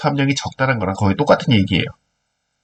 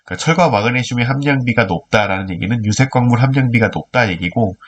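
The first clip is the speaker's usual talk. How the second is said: ordinary delivery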